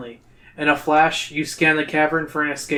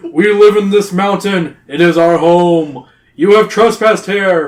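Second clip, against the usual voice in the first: loud, slow, raised royal pronouncement; stern cave king; 3 semitones higher, 8 dB louder than usual. A narrator's reading. Cave king accuses the trespassers, slow loud booming. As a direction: declaim slowly and loudly from a throne.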